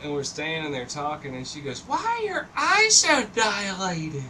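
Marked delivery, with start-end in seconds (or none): 1.8-4.2 high, loud imitation of mom; mock a mother's shrill demand; alarmed mother. Mom confronting her tripping kid upstairs, alarmed and high-pitched.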